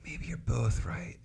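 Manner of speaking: quietly dawning on you; soft realization. Retold or imitated.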